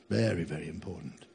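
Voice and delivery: soft echo. Real, not acted. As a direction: let the echo drop away to a hush. Soft echo of previous line.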